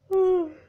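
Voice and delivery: a heavy, loud sigh that breaks the story; loud sigh before apologizing. Real, not acted. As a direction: let out a big pained sigh. Sighing while telling painful story.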